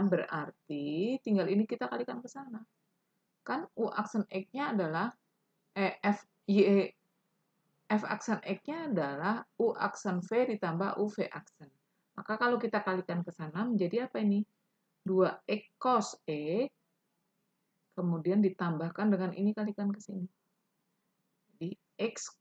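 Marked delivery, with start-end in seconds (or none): none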